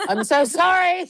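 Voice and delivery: sorry but cracking up; apologising to the cohost for ruining calamari, laughing; laughing apology. Real, not acted.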